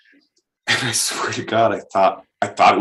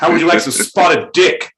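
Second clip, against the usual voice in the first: lightly high, loud mock British voice; a hint of a cheeky English host; 9 semitones higher, 6 dB louder than usual. Teasing the English followers with a mock British offer. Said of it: "mocking English followers".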